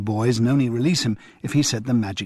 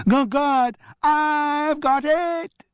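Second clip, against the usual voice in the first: very high, slow squealed echo; child shout; much slower than usual. Acted. Damien echoing the outburst in a very high squeal. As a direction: squeal it out like a little boy. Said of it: "shouted Damien".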